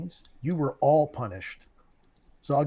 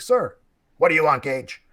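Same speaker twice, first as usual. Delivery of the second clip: loud clipped military bark; barking drill instructor; 6 dB louder than usual. Retold or imitated. Drill instructor answers recruit.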